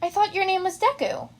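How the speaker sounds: high puzzled question; confused girl voice. Acted. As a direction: ask innocently confused.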